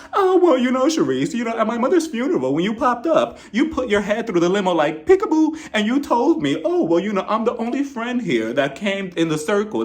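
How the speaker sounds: crank the pitch up and mock her story; mocking Karen. Mocking Karen retelling the funeral.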